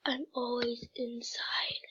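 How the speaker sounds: slow whispered aside; hushed mock confession. Real, not acted. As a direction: whisper it to yourself, slowly. Whispering a quiz answer aside.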